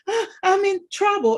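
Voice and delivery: high, loud wailing "Ah, I'm in trouble"; imitating crying child. Mother imitates daughter crying about being in trouble, high wailing. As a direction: whimper it like a scared little girl.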